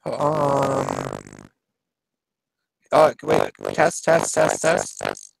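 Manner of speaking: staticky crackling mic test; testing through a scratchy mic. Real, not acted. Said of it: "really scratchy".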